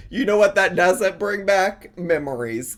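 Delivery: ordinary delivery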